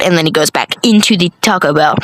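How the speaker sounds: normal talking voice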